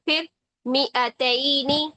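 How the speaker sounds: ordinary delivery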